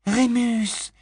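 high called-out name; cry out. A narrator's reading. Tonk calls out to Lupin as she lands, high. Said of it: "s'écria Tonk".

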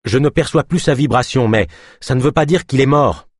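reply firmly, keeping hope; calm warrior voice. Acted. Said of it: "lança Deux Lunes".

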